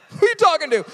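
high-pitched, affronted comeback; indignant joking retort; mock offence. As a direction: play at being insulted. Acts mock offence at the proverb.

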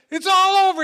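high, very loud outburst; shouted announcement. Retold or imitated. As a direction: yell it out as the wild cop. Retold cop abruptly ends the standoff.

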